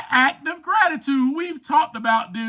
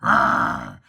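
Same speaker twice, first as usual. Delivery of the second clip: slow, very low playful roar; lion roar; 3 semitones lower, much slower than usual. Acted. Lion cub declaring itself a lion, slow low roar. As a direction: draw it out into a low lion growl. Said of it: "for I am a lion".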